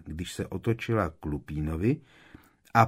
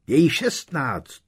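loud, higher, slow shriek; mother's slow shrill cry; 9 semitones higher, 7 dB louder, slower than usual. Acted. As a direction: shriek it in protest. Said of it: "zaječela paní Weasleyová".

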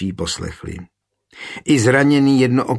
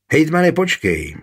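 higher mock call to stop the captain; 6 semitones higher than usual. Olbrámek calls out to the captain to wait, in a raised voice. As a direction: call after him so he waits. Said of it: "křikl Olbrámek na Jana Talafuse".

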